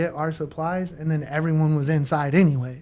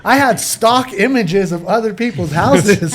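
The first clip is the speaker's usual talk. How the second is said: laughing; 4 semitones higher, 8 dB louder than usual. Real, not acted. Laughing while telling embarrassing story.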